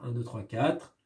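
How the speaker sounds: soft counting of the beats; tally the syllables quietly. Shown as a demonstration. Teacher counting beats for the class, soft. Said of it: "le rythme du poème".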